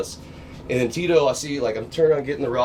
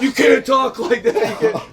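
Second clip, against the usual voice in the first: scolding shout; 11 semitones higher, 5 dB louder than usual. Retold or imitated. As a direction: bark it back like the pitching coach, amused. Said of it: "And then he's like".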